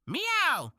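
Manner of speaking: playful falling meow; cute cartoon kitty meow; cat meow. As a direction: meow like a friendly kitten. Kitty cat greets viewers.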